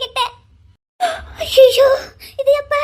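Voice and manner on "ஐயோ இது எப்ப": shocked cry. Acted. Grandmother hears about the loan.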